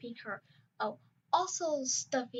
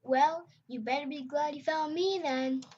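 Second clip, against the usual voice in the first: put-on a bit higher, eager young dragon; excited; 6 semitones higher than usual. Barry eager to guide a new friend, mildly excited. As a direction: sound thrilled to help. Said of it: "excited that".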